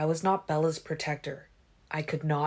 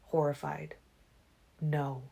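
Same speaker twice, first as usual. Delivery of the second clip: ordinary delivery, nothing marked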